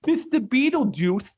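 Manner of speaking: high, slow puppet voice; squeaky hand-puppet book, drawn out; talking book puppet. Retold or imitated. Podcaster voicing the puppet book asking Beetlejuice, high and slow. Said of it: "makes it talk with his hands".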